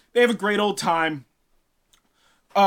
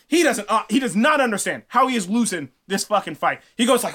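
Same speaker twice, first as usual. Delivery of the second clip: normal talking voice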